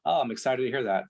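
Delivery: mock unexcited, monotone delivery; robotic monotone. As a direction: imitate someone saying it with no excitement. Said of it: "you said it like this".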